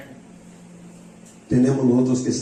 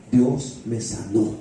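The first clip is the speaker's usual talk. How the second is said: slow, measured testimony, lower in pitch; declaración solemne; 5 semitones lower, much slower than usual. Retold or imitated. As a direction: let each word land slowly. Testimony climax, healed man's declaration. (declaración lenta y solemne, en tono grave)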